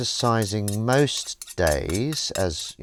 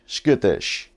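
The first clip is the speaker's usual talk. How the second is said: loud skittish; 7 dB louder than usual. Pretend. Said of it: "Would you be happy being skittish".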